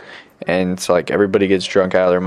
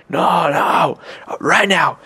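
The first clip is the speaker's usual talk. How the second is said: put-on high, insistent drunk voice; imitated drunk demand; 7 semitones higher, much slower than usual. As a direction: mimic a drunk refusing to wait. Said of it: "drunk and drugged up".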